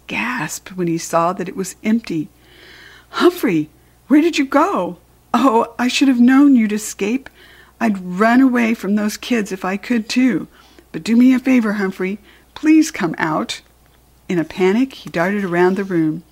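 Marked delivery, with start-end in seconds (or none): 3.1-13.6 raised, louder call for a lost pet; worried search, voice lifted; alarmed pleading. A narrator's reading. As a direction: call out higher and louder, pleading for him to come back. Pleading after the escaped hamster.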